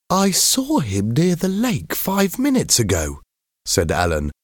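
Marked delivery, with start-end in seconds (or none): 0.1-3.2 put-on high-pitched boy's voice; schoolboy character. A narrator's reading. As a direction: play a young schoolboy, pitched right up. Alan's voice in story dialogue.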